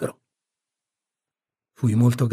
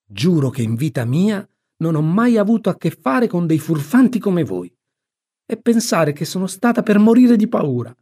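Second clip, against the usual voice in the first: higher, exasperated scolding; indignant outburst; 6 semitones higher, 4 dB louder than usual. A narrator's reading. Aunt explodes at the two rascals, indignant outburst. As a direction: burst out scolding in outrage. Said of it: "e poi sbottò".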